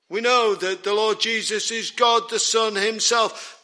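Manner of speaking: ordinary delivery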